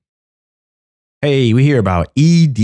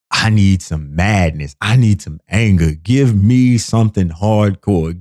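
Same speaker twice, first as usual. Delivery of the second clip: normal talking voice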